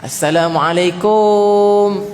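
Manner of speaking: "Assalamualaikum" dragged very long, high and loud at the end; over-stretched salam. Shown as a demonstration. Preacher mocking women who over-lengthen the salam, high and loud. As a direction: stretch the last syllable of "Assalamualaikum" far too long. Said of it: "dia buat ma'arik li sukun".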